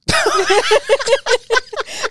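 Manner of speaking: a high, bubbling giggle; laughing at her own announcement. Real, not acted.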